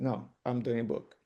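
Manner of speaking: normal talking voice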